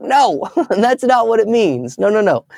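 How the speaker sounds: high-pitched, laughing mock protest; drawn-out, high "no" with a chuckle; amused correction. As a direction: imitate an exasperated teacher through a laugh. Retold teacher correcting his mistake, laughing.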